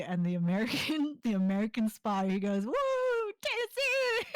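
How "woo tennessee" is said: high-pitched mock cheer; excited cheer. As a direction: whoop for the home state. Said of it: "he screams out".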